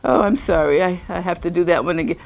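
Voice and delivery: ordinary talk, nothing marked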